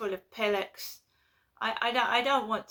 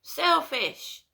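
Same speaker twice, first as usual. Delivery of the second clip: angry retort; 5 semitones higher, 4 dB louder than usual. Real, not acted. Ranting about train passengers.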